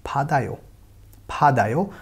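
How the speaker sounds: फादायो said slowly and clearly, d sound in the middle; teacher modelling फादायो; Korean pronunciation demo. Shown as a demonstration. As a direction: spell out फादायो syllable by syllable. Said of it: "इसका sound हो जाएगा".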